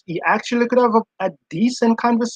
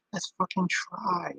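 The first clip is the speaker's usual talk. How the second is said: soft, slow and calm, the curse dropped gently; calm cursing; 13 dB quieter than usual. Retold or imitated. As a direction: swear as if it costs nothing, very quiet and unhurried. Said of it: "cursing in a calm voice".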